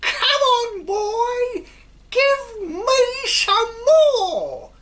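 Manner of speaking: put-on shrieking old hag's whoop, very high and loud; cackling witch-like cry of glee; old hag shrieking with delight; screeching shout. A narrator's reading. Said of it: "she yelled".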